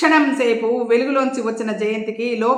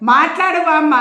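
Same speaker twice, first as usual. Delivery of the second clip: loud shout; 5 semitones higher, 5 dB louder than usual. A narrator's reading. Jayanthi shouts at her collapsed grandmother, loud and alarmed. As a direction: shout higher and louder, urgent. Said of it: "బిగ్గరగా అరిచింది".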